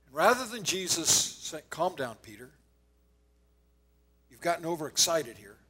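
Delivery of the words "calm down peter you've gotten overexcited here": low, soft, soothing voice; calming down. Retold or imitated. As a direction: gently settle an overexcited friend. Imagined Jesus calming Peter.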